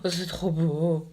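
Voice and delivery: gasp, then slow, low admiration; awed delight. Real, not acted. Vlogger admiring a shiny swatched highlighter, awed.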